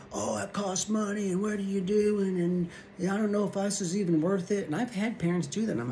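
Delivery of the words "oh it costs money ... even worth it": a hint of a whining high parent voice; mock a complaining parent, pitched up; whiny parent. A parent griping about costs, lightly mocked in a higher voice.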